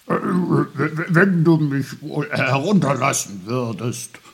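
put-on loud, flustered stammering old uncle; indignant stutter. A narrator's reading. Little old uncle held wrongly by boy, stuttering indignantly. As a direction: splutter in indignation, tripping over the first words. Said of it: "stotterte Onkel Pfefferkorn entrüstet".

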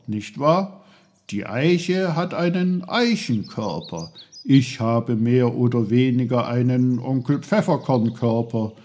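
loud, bursting know-it-all voice; a loud, cheeky little helper; know-it-all little uncle, teasing. Acted. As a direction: barge in loudly with a teasing "oh, oh, oh". Voices Onkel Pfefferkorn.

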